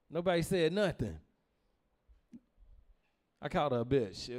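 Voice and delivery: ordinary talk, nothing marked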